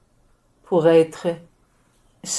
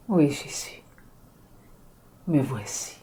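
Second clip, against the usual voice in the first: low, soft reverent murmur; tender surrender; 6 semitones lower, 5 dB quieter than usual. Acted. Dying woman answering Jesus, soft reverent murmur. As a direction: quietly give yourself over.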